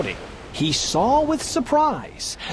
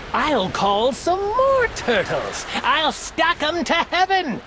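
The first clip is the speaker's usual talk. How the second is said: higher, haughty royal voice; a proud king outraged by the moon; indignant turtle king; 4 semitones higher than usual. Acted. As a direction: proclaim it as a vain king. Yertle vows higher throne.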